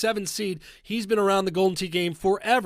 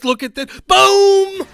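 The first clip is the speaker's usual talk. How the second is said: huge high shout, near breathless; shrieking play-by-play call at an eagle; screaming excitement; 12 dB louder than usual. Real, not acted. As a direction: scream it like you can't believe the shot. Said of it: "I'm struggling to breathe".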